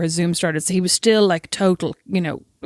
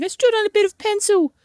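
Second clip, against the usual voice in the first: put-on much higher little-boy voice; whiny little boy; 13 semitones higher than usual. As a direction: mimic the hurt child. Mother mimics crying injured son.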